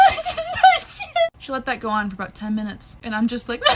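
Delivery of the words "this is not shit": loud, very high hammed-up wail; mock sobbing. Five-year-old freaking out over a phone call, mock hysterical sobbing. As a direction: imitate a child sobbing hysterically. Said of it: "I start sobbing".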